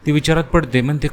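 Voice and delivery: ordinary delivery, nothing marked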